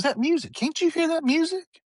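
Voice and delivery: very high-pitched granny voice; insistent grandmother. Retold or imitated. Granny insists she hears music, retold.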